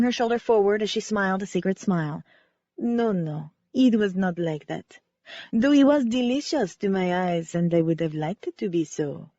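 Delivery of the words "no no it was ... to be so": lightly voiced French ballerina, sincere; a hint of French accent, remembering gratefully. Ballerina Cece telling the amateur sleuth how Wynne saved her, light French accent. Cece's voice continues.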